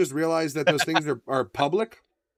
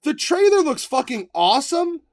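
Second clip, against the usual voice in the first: ordinary talk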